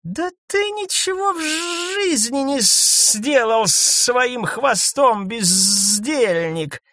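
high, slow scornful outburst; scornful exclamation. A narrator's reading. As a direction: sneer it at him. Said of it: "воскликнула крулянка". (презрительный возглас)

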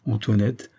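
ordinary delivery

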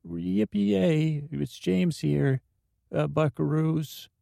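yee-haw it up like a goofy cowboy; cowboy James voice. Pretend. Playing James Cash Penny, hammed-up cowboy greeting. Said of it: "so that's me".

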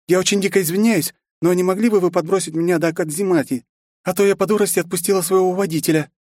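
high, lively princess voice. A narrator's reading. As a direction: voice the princess brightly. Ayumi voiced in story. (голос принцессы)

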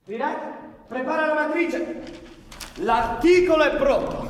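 high, loud call to a coworker. Acted. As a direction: shout the order to a colleague. Calls out across the newsroom.

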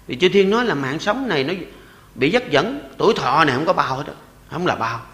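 normal talking voice

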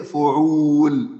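فعول drawn out slowly and firmly. Shown as a demonstration. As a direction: spell out the pattern فعول deliberately. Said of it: "قروء تبقى إيه؟".

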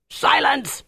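high-pitched sharp shout; shouted command. A narrator's reading. Officer cuts off a man about to talk, shouting. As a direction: shout them into silence. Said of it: "Dieter shouted in German".

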